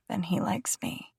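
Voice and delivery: hushed, voiceless aside; bitter whispered afterthought. A narrator's reading. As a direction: breathe it out under the breath.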